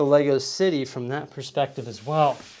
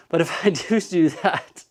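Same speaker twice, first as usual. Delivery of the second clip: laughing; 4 semitones higher than usual. Real, not acted. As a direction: repeat do do while laughing. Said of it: "I said do do".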